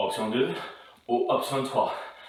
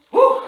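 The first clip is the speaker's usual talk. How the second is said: loud high-pitched whoop; 6 dB louder than usual. Real, not acted. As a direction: let out a sharp whoop. Shout after set.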